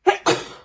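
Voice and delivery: loud, sudden real sneeze. Real, not acted. Crafter interrupting her card tutorial, loud sneeze. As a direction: let out a quick loud sneeze. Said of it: "I'm gonna sneeze".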